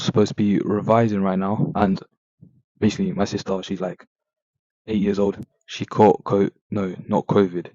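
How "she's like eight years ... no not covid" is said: ordinary talk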